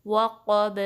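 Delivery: وقب loud, b rebounds at the end; heavy qa in وقب, then a bounced b; echoing qalqala. Shown as a demonstration. Teacher showing voice returning, وقب with echoing b. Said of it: "ہماری آواز پلٹ کے آئے گی".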